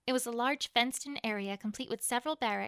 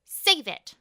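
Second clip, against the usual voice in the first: loud, higher furious cutoff; angry snap; 5 semitones higher, 13 dB louder than usual. Acted. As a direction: cut him off with rage. Queen silences prisoner.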